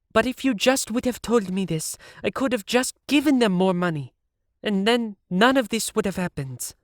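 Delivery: rounding on the producer in his chair; angry young woman; angry Siv. A narrator's reading. Siv complains.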